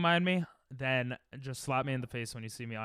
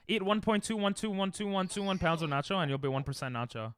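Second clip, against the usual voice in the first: ordinary talk, nothing marked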